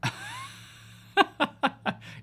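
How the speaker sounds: high-pitched burst of laughter; long, helpless high giggle; laughing. Real, not acted. Amused at the game mishap.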